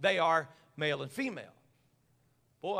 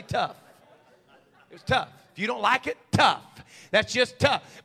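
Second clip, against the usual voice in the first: mama's tough; 3 semitones higher, 7 dB louder than usual. Retold or imitated. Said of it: "she'd just say".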